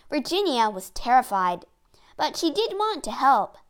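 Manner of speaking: ordinary delivery, nothing marked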